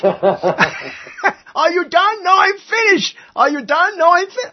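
high, loud jokey back-and-forth; hammed-up skit dialogue. Host performs a finished-Finnish pun skit, loud and high. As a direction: ham up the pun with a laugh. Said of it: "It says".

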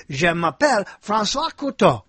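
put-on French accent; demonstrated French speech; French pronunciation. Shown as a demonstration. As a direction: show off a French self-introduction. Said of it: "So I do know some of it".

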